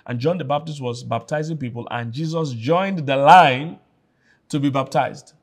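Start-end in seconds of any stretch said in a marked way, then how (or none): none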